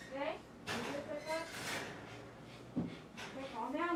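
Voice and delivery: high, soft coaxing voice; talking to a dog. Real, not acted. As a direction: coax the dog gently, up high.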